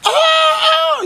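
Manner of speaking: long falsetto "aah"; imitated girly squeal. Mocking cartoon spy girls to viewers, high falsetto squeal.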